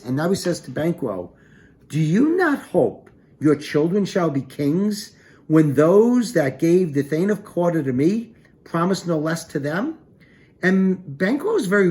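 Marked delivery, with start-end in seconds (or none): 1.9-10.0 slow, louder recital of Macbeth's lines; dramatic Shakespeare reading. Acted. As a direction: read it like a stage actor, a little higher and louder. Teacher reads Macbeth's lines aloud.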